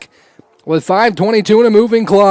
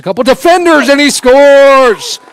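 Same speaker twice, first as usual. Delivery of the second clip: goal call; 6 semitones higher, 5 dB louder than usual. Real, not acted. Goal call by play-by-play announcer.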